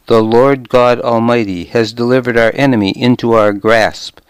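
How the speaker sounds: ordinary delivery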